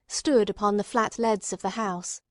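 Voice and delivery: usual speaking voice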